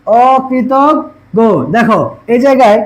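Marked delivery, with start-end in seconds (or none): 0.1-1.4 অকৃতজ্ঞ said very slowly, syllable by syllable, the গ doubled; slow pronunciation demo. Shown as a demonstration. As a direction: stretch out অকৃতজ্ঞ to show the doubled sound. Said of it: "দ্বিতীয় উচ্চারণ করতেছি". (অকৃতজ্ঞ ধীরে ধীরে, দ্বিত্ব উচ্চারণ দেখিয়ে)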